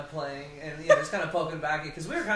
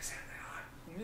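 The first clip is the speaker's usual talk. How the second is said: whisper; 3 semitones higher, 14 dB quieter, much faster than usual. Pretend. Teasing correction.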